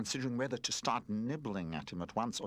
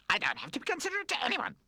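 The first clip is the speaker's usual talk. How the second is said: much higher, louder spoiled brat voice; spoiled baby doll bullying the toys; bratty baby threat; 4 dB louder than usual. A narrator's reading. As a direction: threaten them shrilly as the baby. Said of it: "said Pamper".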